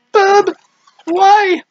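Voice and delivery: high, loud pleading outcry; distressed game heroine. Acted. Game heroine struggling for the knife, high loud plea. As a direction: cry out in a shrieky high character voice. Voices game character crying out.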